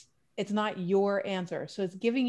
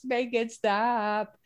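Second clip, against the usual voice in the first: mock weary plea; much slower than usual. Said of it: "'Cause it's like".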